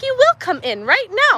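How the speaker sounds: lightly voiced bossy toddler; a hint of a bossy little girl. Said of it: "she's definitely in charge".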